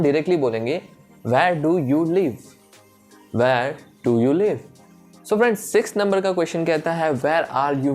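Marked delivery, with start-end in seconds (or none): none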